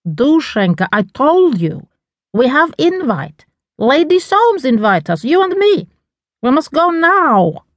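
exasperated foreign mother, pitch climbing. A narrator's reading. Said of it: "Lyudmila rolled her eyes".

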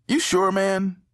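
high, doubtful far-away call; distant voice. A narrator's reading. Brandon checks from his hiding spot, distant voice. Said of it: "replied in a distant voice".

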